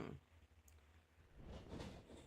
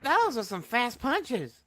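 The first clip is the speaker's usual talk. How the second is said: excited; 5 semitones higher, 7 dB louder, slower than usual. Real, not acted. Commentator reacts to fast punches.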